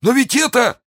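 high, alarmed cry; alarmed exclamation. Acted. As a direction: cry out in dismay. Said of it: "воскликнул разбойник".

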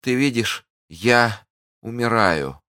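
high, weakening dying woman; plaintive lifted voice; desperate plea. Acted. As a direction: plead as life ebbs, slowing at the end. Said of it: "вскричала госпожа Бонасье".

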